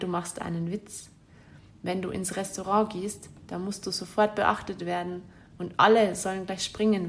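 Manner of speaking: normal talking voice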